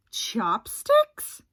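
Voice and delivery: ordinary delivery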